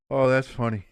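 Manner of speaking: usual speaking voice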